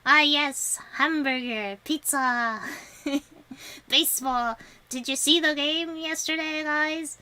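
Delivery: hammed-up American accent, showing it off; mock American accent. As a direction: put on a caricature American accent. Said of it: "can you speak American?".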